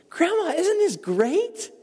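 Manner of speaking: put-on high, slow, delighted child voice; excited little girl. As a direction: voice a small girl beaming at her grandma.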